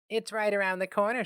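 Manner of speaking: a hint of a courteous receptionist. A narrator's reading. Receptionist speaks to David.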